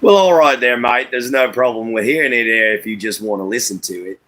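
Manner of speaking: performing an Australian accent on request; easygoing Aussie accent. Shown as a demonstration. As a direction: put on a friendly Australian accent. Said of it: "Can we hear the Australian accent?".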